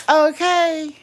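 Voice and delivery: loud high okay; Unbiased's okay. Retold or imitated. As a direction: shout her catchphrase. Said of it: "Nobody can say that okay like Unbiased".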